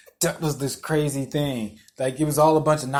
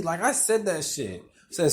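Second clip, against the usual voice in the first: normal talking voice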